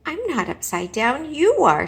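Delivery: loud, rising to a shout on you; young bat protesting loudly; indignant insistence. Acted. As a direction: insist hard, punching you. Bat insists to bird family.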